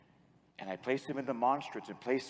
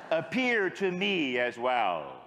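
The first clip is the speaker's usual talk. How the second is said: louder, very slow plea; fervent prayer; 4 semitones higher, 3 dB louder, slower than usual. Shown as a demonstration. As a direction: plead it out slowly. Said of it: "with a little more unction".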